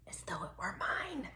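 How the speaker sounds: sneaky whisper. Acted. Dachshund sneakily claiming the bed, whispered. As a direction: breathe it like a secret.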